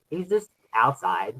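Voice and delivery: ordinary delivery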